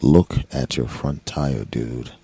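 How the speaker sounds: low, slow, spooky dude voice; deep, dragged-out warning from nowhere; mysterious stranger. Retold or imitated. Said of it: "something says".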